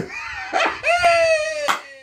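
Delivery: high crying laugh shout; shrieking laugh. Real, not acted. Preacher cracks up at his own accent, shrieking laugh. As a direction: burst into a loud shrieking laugh.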